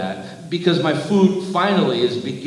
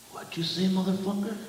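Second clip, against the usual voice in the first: very soft, higher-pitched threat; hushed menace; 6 semitones higher, 7 dB quieter, faster than usual. A narrator's reading. Giant leaning down to the driver, hushed menace. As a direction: lean in with a dangerously quiet challenge.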